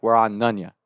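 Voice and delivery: usual speaking voice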